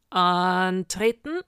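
loud, drawn-out military call; thundering command. Acted. Sergeant summoning his witches, thundering command. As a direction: thunder it out slowly across the yard. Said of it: "donnerte er".

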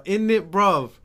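high, loud mock British roadman; British roadman accent. British homegirl teaching roadman talk, mock British roadman accent. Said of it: "how to talk like a fucking roadman".

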